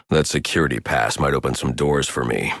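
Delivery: usual speaking voice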